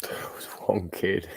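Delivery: whispered aside; whispering a correction to the story. Real, not acted. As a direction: breathe it under your breath. Aside under breath.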